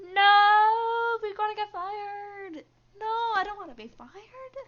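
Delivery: high, loud panicked cry. Acted. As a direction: shriek it in rising panic. Panics after finding missing money.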